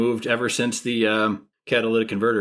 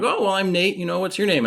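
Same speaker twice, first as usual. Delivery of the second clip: overly friendly; 10 semitones higher than usual. Retold or imitated. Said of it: "And I'm like".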